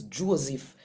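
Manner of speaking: usual speaking voice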